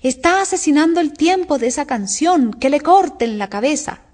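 mild storybook screech; mock shriek. A narrator's reading. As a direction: cry it out high, contained. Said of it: "cuando la duquesa chilló".

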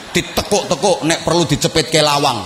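usual speaking voice

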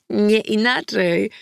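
short chuckle, then slow playful words; grinning amusement. A narrator's reading. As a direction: chuckle and draw it out through a grin. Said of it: "wyszczerzyła się w uśmiechu". (rozbawienie, z szerokim uśmiechem)

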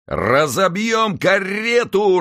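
high, loud, slow mob yell; crowd's shout. A narrator's reading. The crowd shouting to smash the carriage, high slow mob yell. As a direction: roar it as an angry mob. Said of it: "раздались крики в толпе".

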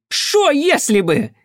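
high, loud, drawn-out indignant retort; flaring up. A narrator's reading. Armed mother cuts into his explanation, flaring up. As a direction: throw the words back at a high pitch. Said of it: "тут же взвилась та".

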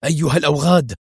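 a rough angry call, still held back; first curse, building toward a scream; angry cry. A narrator's reading. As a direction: start cursing, not yet at full volume. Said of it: "وهو يصرخ".

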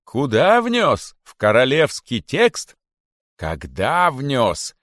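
loud, very slow rhetorical questions; bitter sarcasm. A narrator's reading. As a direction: stretch each question in outrage. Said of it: "с горестным сарказмом". (с горестным сарказмом, протяжно)

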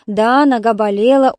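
plain reading voice

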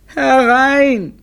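hammed-up, stretched cry of suffering. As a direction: wail the call out in agony. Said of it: "mit leidender Stimme".

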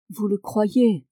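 usual speaking voice

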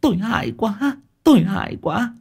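high-voiced shaken woman recounting a nightmare; wife tells husband her nightmare, high and frightened. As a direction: tell him the dream still trembling.

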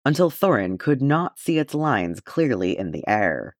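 usual speaking voice

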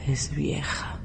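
breathy whisper, barely voiced; secret whisper. Aura whispers to Felipe about her aunt in secret. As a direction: whisper it so the aunt cannot hear.

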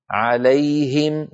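slow "عليهم", alay-him with full ه; clear audible ه. Shown as a demonstration. Teacher repeating the correct word, clearly articulated. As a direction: stretch "عليهم", unforced distinct ه. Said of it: "فنبين صوت الهاء".

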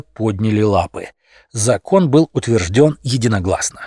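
usual speaking voice

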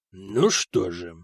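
low, slow, bored drawl. A narrator's reading. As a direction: draw it out with total boredom. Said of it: "всё тем же скучающим голосом".

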